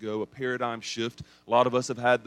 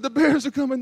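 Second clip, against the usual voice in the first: groggy panic; 12 semitones higher, 6 dB louder than usual. Retold or imitated. Half-asleep panic.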